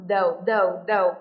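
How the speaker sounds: "though" repeated low with a clear O; low, punched repeats of "though"; O vowel demo. Shown as a demonstration. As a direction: sound out "though" as TH plus O. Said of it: "Và đây là O".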